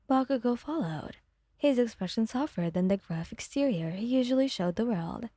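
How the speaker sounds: usual speaking voice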